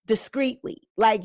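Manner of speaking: ordinary delivery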